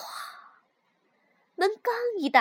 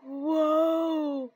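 drawn-out wondering wow; amazed gasp. A narrator's reading. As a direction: let the wonder spill out. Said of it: "跳跳惊叹道".

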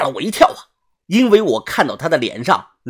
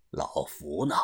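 低沉轻声地问; 压着嗓子缓缓发问; 沉稳的把头; 13 semitones lower, 16 dB quieter, slower than usual. Acted. 把头低沉地发问. (把头低声沉稳地问话)

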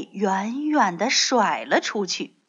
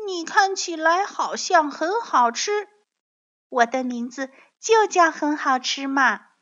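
put-on high, eager child dinosaur voice; innocent baby dinosaur; 6 semitones higher, 4 dB louder than usual. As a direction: chirp it innocently as the little one.

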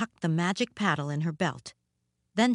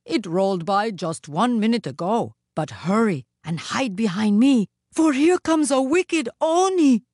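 loud warning rising to high alarm; urgent warning; 4 semitones higher, 6 dB louder than usual. A narrator's reading. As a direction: build from firm to alarmed. Jizo urgently warns her to hide.

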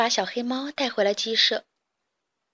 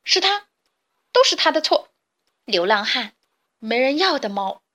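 angry accusation; 2 semitones higher, 4 dB louder than usual. Acted. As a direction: point at the cat and rage. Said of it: "气鼓鼓地冲向了小黑猫".

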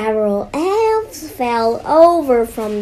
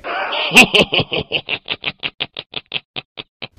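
evil laugh; 7 semitones lower, 5 dB louder than usual. Acted. Villain gloats over his plan.

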